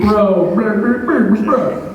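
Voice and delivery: a hint of two loud guys yelling bro; lightly mock the loud bros; loud bro talk. Comic mimics hecklers at the back chatting bro, bro. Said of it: "talking very loudly to each other".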